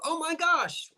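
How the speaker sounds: high-pitched mock dismay at own accent; acted-out cringing surprise. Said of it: "hear your accent and you go".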